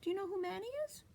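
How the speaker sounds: usual speaking voice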